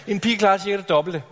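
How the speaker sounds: ordinary talk, nothing marked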